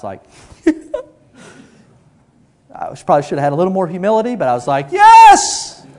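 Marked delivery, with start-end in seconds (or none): 4.9-5.9 huge high-pitched shout; loud, drawn-out cheer, hammed up; triumphant yell. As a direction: explode with victory. Retold triumph at perfect score.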